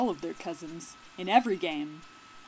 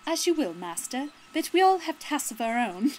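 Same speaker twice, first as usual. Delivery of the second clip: raised, put-on voice for an obedient henchman; dutiful follower; 6 semitones higher, 6 dB louder than usual. Follower answering his master about their tasks, raised put-on voice. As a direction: defer to the master in a higher character voice.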